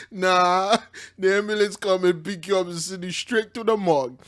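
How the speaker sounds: normal talking voice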